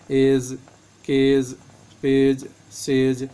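एज, केज, पेज, सेज slowly, each ending in a j sound. Shown as a demonstration. Teacher showing G said as J at word ends, G as J. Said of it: "इसका उच्चारण जा होता है".

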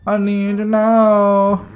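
drawn-out plea. Real, not acted. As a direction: drag out every word, pleading. Plea repeated, stretched.

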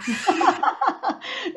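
real laugh breaking out high; high burst of laughter. Real, not acted. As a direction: laugh at your own obvious fib. Laughs at her own fib.

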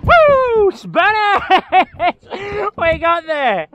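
high, loud cheer over the catch; whooping with joy at the fish; excited whoop. Real, not acted. Angler cheering as the Spanish mackerel lands, loud and high.

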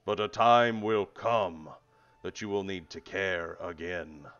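slow, loud, commanding spirit voice; booming voice. Acted. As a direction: boom it out like a ghostly judge. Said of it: "a booming voice calls out".

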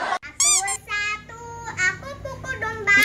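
quiet rhythmic chant; soft sing-song. Pretend. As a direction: chant it softly. Zacht kinderliedje.

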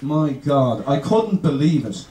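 low, slow drawl; mock-slow, deep delivery; slow Alabama parody. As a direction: drag it out low like a slow talker. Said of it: "Those people speak so slowly".

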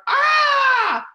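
high, loud fright shriek; scream. Retold or imitated. Demonstrating the roach reaction, a loud high shriek. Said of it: "roaches are".

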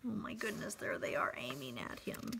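breathless hush of alarm; alarmed whisper. Real, not acted. Storyteller aside, rifles aimed.

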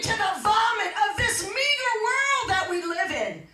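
high, slow, impassioned rebuke; full-force preaching. Acted. Mother rebuking her daughter for returning to the world, full-force preaching. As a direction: cry out each phrase with long stressed words.

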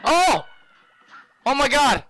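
high panicked cries; panicking gamer. Real, not acted. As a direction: cry out in panic. Gamer panicking under attack.

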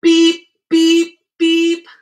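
loud, high-pitched beep; little truck beep. A narrator's reading. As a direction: toot like a friendly little truck. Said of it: "what do you predict Little Blue says".

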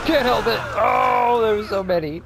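high, loud thrilled yelling; excited outburst. Real, not acted. Player reacting to the game action, loud and thrilled. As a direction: burst out with glee at the chaos.

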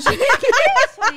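laughing helplessly, slow and high; cracking up mid-word; hysterical laugh. Real, not acted. Guest spills, bursts out laughing.

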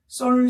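"썰" held on a high level note; first tone. Shown as a demonstration. Teacher showing students the first tone, "썰" high and level. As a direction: say "썰" high and unwavering, like a sustained note. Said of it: "썰 이런 톤이에요".

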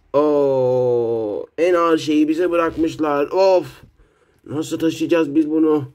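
loud, slow strained lifting voice; straining kid voice. Acted. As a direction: groan under a heavy load. Character straining with heavy load.

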